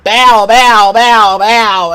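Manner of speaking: booming bow bow imitation; hype-man bow bow, loud; loud mock bow bow. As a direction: punch each bow out loud like a taunt. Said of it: "and he was like".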